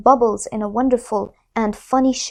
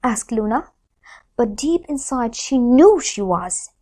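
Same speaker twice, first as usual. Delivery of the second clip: usual speaking voice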